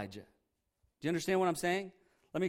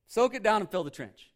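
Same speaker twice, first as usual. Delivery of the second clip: bold command; 5 semitones higher, 7 dB louder than usual. Retold or imitated. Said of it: "He's like".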